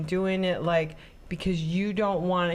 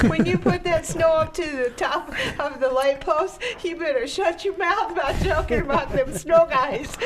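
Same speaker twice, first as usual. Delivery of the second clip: high, louder, giggling through a mock warning; laughing mock threat; 8 semitones higher, 4 dB louder than usual. Joking about snow plow drivers, mock threat laughed out high and loud. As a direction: threaten them, but keep cracking up.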